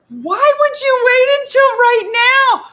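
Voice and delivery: high, loud disbelieving squeal; exasperated shriek. Retold or imitated. Narrator exasperated with friend, high squeal.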